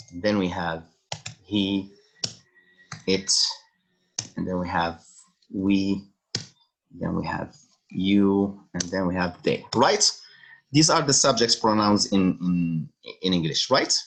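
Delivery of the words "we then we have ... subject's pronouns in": ordinary delivery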